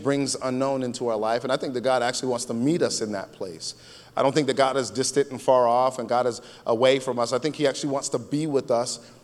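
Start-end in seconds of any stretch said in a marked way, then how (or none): none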